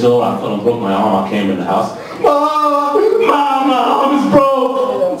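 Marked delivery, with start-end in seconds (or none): 2.2-5.2 much higher, slow whining cry for mom; hurt little kid. Retold or imitated. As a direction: whimper to your mother like a hurt eight-year-old. Kid with broken arm.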